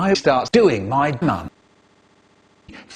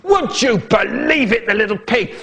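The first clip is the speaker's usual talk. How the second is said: roaring dad; 7 semitones higher than usual. Acted. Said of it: "My dad is roaring".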